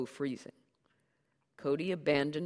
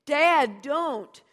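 high, loud desperate plea; pleading daughter; 13 semitones higher, 14 dB louder than usual. Acted. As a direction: cry out to stop him. Daughter stops her father.

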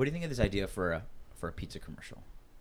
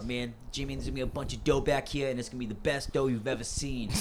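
a hint of a Boston robber accent; lightly voiced Boston crook bragging about the dough; 2 semitones higher than usual. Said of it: "they have a little bit of Boston accent".

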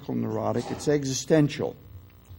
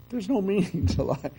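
laughing; 5 semitones higher than usual. Real, not acted. Laughs while speaking.

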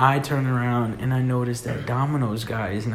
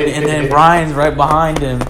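normal talking voice

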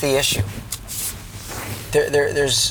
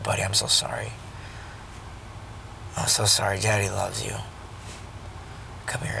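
soft, lower, hushed reassurance; tender apology; 4 semitones lower, 10 dB quieter, faster than usual. Retold or imitated. Father telling his son he is sorry, soft tender reassurance. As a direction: comfort him softly, close up.

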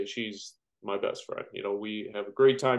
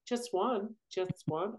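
much higher, pleading mock girlfriend voice; imitating girlfriend's begging; 10 semitones higher, 6 dB quieter, slower than usual. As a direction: beg like the girlfriend asking for a road pop, much higher.

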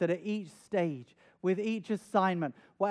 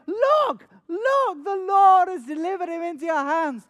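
loud, high-pitched urgent cry; shouted urging, pitched way up; urgent scream; 10 semitones higher, 8 dB louder than usual. Acted. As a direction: scream it to make him act. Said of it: "but they're screaming at him".

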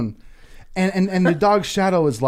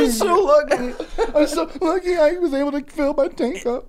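mock sobbing gratitude; 10 semitones higher than usual. Mocking a grateful crier.

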